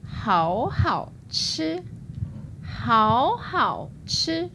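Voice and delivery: 好好吃 said very slowly, syllable by syllable, second tone then third then first; slow clear tones. Shown as a demonstration. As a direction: model each tone of 好好吃 slowly for learners. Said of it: "I've got one that's three words".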